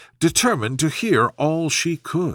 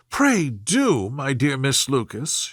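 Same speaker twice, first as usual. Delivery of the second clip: ordinary delivery, nothing marked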